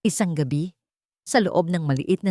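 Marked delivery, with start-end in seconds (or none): none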